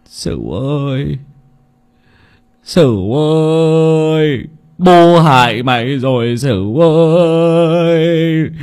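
very slow, drawn-out grieving cry; anguished wailing. Acted. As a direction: wail the son's name in despair. Dying father wailing for his son.

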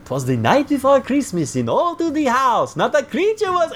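high-pitched sitcom character impression; Balky. Balky reciting Christmas poem from the closet, high character voice. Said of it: "Balky is in the closet and he says".

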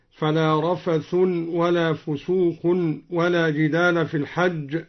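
رفث as "rafathun", فسوق as "fusuqun". Shown as a demonstration. Teacher quoting al-Duri's reading, "-un" on رفث and فسوق. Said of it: "قرأ الدوري بالرفع والتنوين".

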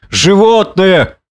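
high, long drawn-out outrage; indignant exclamation. A narrator's reading. Drunken man hears his friend called animal, exclaiming indignantly. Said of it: "воскликнул он".

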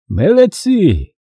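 high, drawn-out slow praise; перекрикивая гвалт. A narrator's reading. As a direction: call praise out over a noisy crowd. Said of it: "перекрикивая гвалт, похвалил Аюб". (протяжная похвала сквозь шум)

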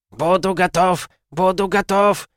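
high, piercing screech; shrill screeching bird woman. Acted. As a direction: squawk it like an excited bird. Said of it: "завопил их Ветрогон". (пронзительный птичий вопль)

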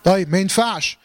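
ordinary talk

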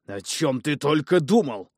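slow, loud, enraged father; furious. Acted. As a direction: scold him in a fury. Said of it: "взъярился отец мальчишки".

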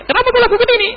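high, pointed scolding question; mock sharp rebuke. Shown as a demonstration. Preacher voicing the rebuke the Prophet never gave. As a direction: snap it like a scolding master.

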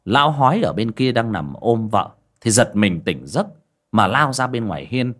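normal talking voice

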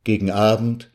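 ordinary delivery

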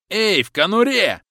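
slow, high, loud yell; yelling through door. A narrator's reading. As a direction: bellow it at the locked door. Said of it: "заорали за дверью". (громкий крик, высоко и медленно)

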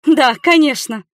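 loud, high-pitched sarcastic retort; scornful snort. A narrator's reading. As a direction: scoff at your mother. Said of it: "фыркнула я".

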